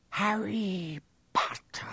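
high, slow, eerie half-whisper. A narrator's reading. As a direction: breathe the name out, drawn long. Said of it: "it whispered".

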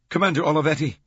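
high, loud urgent address. A narrator's reading. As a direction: demand the commander's attention at once. Urgent phone call to commander.

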